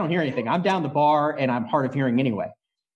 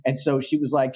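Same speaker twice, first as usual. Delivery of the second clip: normal talking voice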